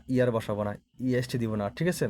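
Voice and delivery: ordinary talk, nothing marked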